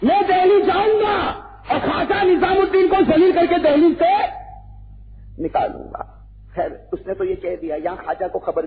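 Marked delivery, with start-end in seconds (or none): 0.0-6.1 high, loud shouted threat; furious royal threat, shouted; king's angry threat. Acted. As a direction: shout it as the jealous king vowing revenge. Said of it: "बादशाह गयासुद्दीन ने कहा".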